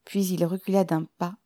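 usual speaking voice

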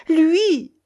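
high, loud gasp of dread; terror. A narrator's reading. Rochaine tells the baron who threatens her, with terror. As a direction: blurt it out in fright. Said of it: "fit-elle avec effroi".

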